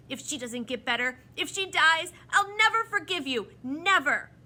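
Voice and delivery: impassioned threat, climbing high and loud; choked with emotion. A narrator's reading. Tom fears for the injured bird, emotional outburst. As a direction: build to an outburst of hurt fury. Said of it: "The boy choked with emotion".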